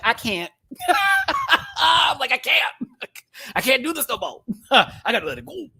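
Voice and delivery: high, loud laughing "I can't"; half laugh, half cry, throwing hands up; cracking up while giving up; laughing exasperation. Retold or imitated. Exasperated quote amid laughter.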